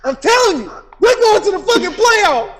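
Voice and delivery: loud, high, defiant insistence; defiant shout. Real, not acted. Fan shouting down his friends about the playoffs, loud and defiant. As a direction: shout it down, no doubts allowed.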